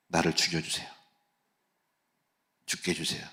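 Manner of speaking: despairing plea. Retold or imitated. Moses begging to die.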